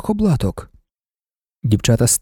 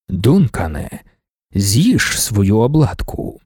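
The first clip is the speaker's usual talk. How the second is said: Leto's command; 6 semitones lower, much slower than usual. A narrator's reading. Emperor tells Duncan to eat the wafer, low and slow. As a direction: tell him gently but firmly to eat. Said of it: "сказав Лето".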